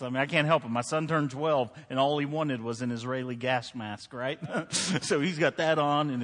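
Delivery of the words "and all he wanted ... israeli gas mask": usual speaking voice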